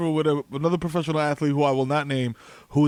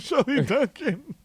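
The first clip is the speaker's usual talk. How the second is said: mock reverence; 8 semitones higher, slower than usual. Real, not acted. Drawn-out repeat of the name.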